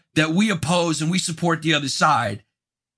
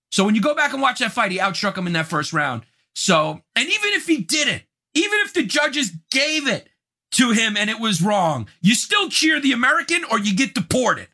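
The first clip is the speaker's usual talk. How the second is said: 1 mock outrage; 6 semitones higher than usual. Real, not acted. Mock decree deporting Russian fans.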